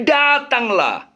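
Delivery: slow, stressed "Datanglah"; preacher stretching "Datanglah" to drive it home; emphatic come. Shown as a demonstration. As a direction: drag out "Datanglah" as the key word.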